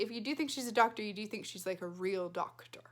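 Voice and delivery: plain reading voice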